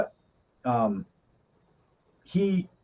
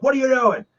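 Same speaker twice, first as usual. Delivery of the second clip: high-pitched, exasperated demand; mock angry captain; 10 semitones higher, 3 dB louder than usual. Captain challenging the conning officer, mock angry. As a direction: imitate a captain getting madder. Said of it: "getting madder, madder. And Captain's like".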